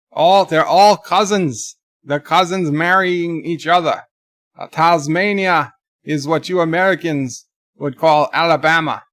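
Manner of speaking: mock Australian accent; Aussie accent. Aussie explaining Tasmania to Americans, in a put-on Australian accent. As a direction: do a comic Aussie running down Tasmania. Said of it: "it's my Aussie accent".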